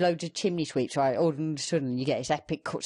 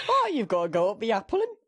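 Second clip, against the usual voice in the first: put-on Cockney accent; 7 semitones higher than usual. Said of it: "everyone just has that voice".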